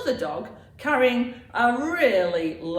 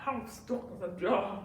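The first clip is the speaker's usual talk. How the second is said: words muffled by sweets; mouth full; 5 dB quieter than usual. Acted. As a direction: talk through a mouthful of sweets. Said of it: "with your mouth full of sweets".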